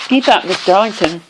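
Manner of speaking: usual speaking voice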